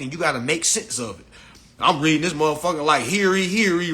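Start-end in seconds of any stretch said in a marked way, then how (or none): 3.0-3.9 lightly voiced old-English crier; a touch of a proclaiming town crier; mild mock Shakespearean read. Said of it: "reading like Shakespeare".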